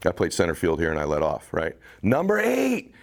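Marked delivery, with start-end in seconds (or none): 2.0-2.9 stretched, shouted ballpark call of the name, much higher; announcer yelling. Retold or imitated. In the dream the announcer calls his name, a drawn-out shouted announcement. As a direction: belt the player's name over the stadium speakers. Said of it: "I hear him yelling".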